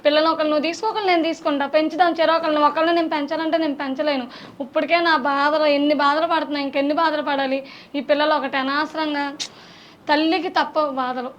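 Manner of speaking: brisk delivery, barely any sadness; quick, matter-of-fact acting. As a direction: rattle off the abandoned mother's lines. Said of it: "ఫాస్ట్గా చెప్పావు". (గబగబా చెప్పే dialogue)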